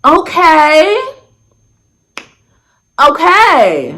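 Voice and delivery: high-pitched, over-accepting okay; feigned non-judgment. Retold or imitated. Storyteller hearing about their hookups, performing non-judgment. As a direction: push it high, acting totally fine with it. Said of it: "My j-, non-judgmental ass".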